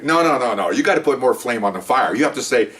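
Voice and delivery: ordinary delivery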